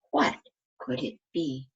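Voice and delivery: plain reading voice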